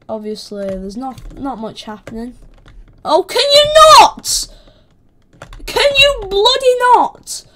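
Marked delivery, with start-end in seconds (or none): 3.0-7.4 high, loud, stretched-out protest; indignant high-pitched yell; exasperated shout. Real, not acted. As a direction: squeal at them to back off. Protesting at attacker again.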